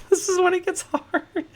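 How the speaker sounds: a squeaky, laughing high-voiced repeat; silly high voice. Streamer to his chat, mocking himself in squeaky falsetto. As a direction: echo the line in a giddy falsetto.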